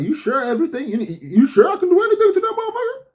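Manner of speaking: high, eager mock devil asking permission. Devil asking God for permission, high and gleeful. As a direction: ham up a gleeful devil checking he can go ahead. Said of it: "So the devil was like".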